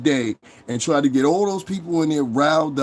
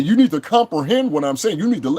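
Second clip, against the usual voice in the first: higher-pitched mocking quote; put-on smart talk, pitched up; mock smart-talker; 5 semitones higher, 3 dB louder than usual. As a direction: imitate a know-it-all lecturing. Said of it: "he said some smart shit".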